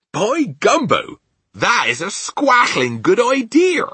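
slow, loud, high exclamation; delighted giant. Acted. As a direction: exclaim with big, drawn-out delight. Giant delighted by her idea.

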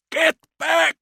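high, full-force shout; hoarse frustrated yell. A narrator's reading. Kord yelling at the mob, hoarse with frustration. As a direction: bellow it in desperate hate. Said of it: "was yelling over and over, his voice hoarse with frustration".

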